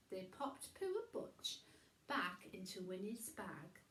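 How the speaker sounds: usual speaking voice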